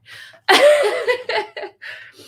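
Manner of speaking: a loud, bursting laugh at herself; embarrassed laugh. Real, not acted. Reader laughing at her own tears. Embarrassed at crying while reading.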